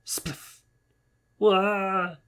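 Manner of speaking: high loud squawks of fright; panicked yelps. Acted. Rabbit sees the wolf coming, yelping in panic. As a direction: yelp in sheer panic.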